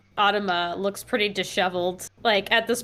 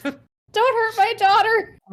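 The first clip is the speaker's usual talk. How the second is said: frightened plea; 12 semitones higher, 5 dB louder than usual. Acted. Character pleads to the beast.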